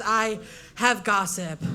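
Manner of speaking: slow, low, secretive tease; conspiratorial hush. Acted. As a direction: savour the juicy secret slowly. Friend removes sunglasses, leans in.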